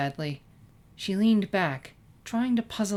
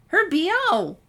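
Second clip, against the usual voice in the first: high, loud gleeful cry; gleeful Pinkie Pie; 12 semitones higher, 8 dB louder, slower than usual. A narrator's reading. Pinkie Pie cracking the joke at lunch, high and gleeful. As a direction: shout the punchline with glee.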